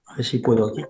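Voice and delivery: distant, faint and muffled; faint mic. Real, not acted. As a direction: speak far from the microphone. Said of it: "no se te oye".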